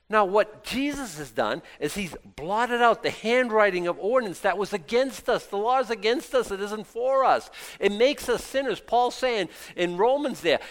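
high, loud insistent sermon voice; forceful preaching. Acted. Preacher warning congregation they still owe, loud insistent preaching. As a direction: drive the point home to the congregation. Preaches about sin and law.

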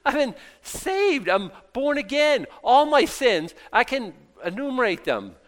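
high, loud, joyful preaching at full force; fervent preaching. Acted. Preacher to congregation about being saved, ecstatic loud preaching. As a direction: proclaim it like the best news ever, laughing with joy.

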